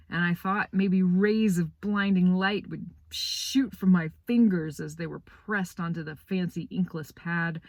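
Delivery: ordinary delivery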